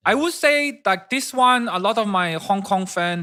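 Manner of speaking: put-on high, clipped Hong Kong English accent. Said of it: "they talk like this all the time".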